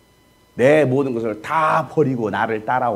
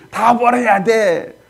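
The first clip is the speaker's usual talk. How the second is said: very drawn-out, high, loud mock pulpit cry; 8 semitones higher, 6 dB louder, much slower than usual. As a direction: imitate a preacher roaring, stretching each word. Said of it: "막 이러고 있으니". (길게 늘여 높고 크게 외치는 설교 흉내)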